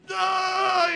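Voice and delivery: dazed acid grunt. Retold or imitated. Stoned on acid, can't talk.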